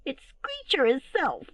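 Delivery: high, slow squeaky tattling; little squeaky voice. A narrator's reading. Littlest owl blaming brother, squeaky voice. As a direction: squeak out the accusation slowly. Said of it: "a little squeaky voice".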